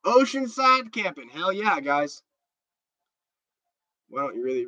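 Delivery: ordinary delivery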